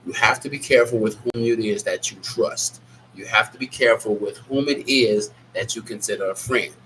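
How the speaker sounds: normal talking voice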